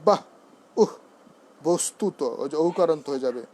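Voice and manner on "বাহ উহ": "বাহ", "উহ" short, each ending on breath; visarga half h. Shown as a demonstration. Teacher recapping visarga, "বাহ" and "উহ" as short aspirated bursts. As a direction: cut "বাহ" and "উহ" off with a light h. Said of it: "বিসর্গ থাকে তখন হবে".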